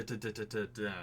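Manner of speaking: ordinary talk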